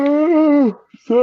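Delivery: sleepy high yawning ooh. Real, not acted. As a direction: yawn it out, still waking up. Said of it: "it's early in the morning for me".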